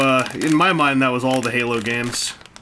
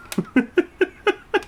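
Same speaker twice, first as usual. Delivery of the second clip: high burst of laughter. Real, not acted. Laughs at missing parts.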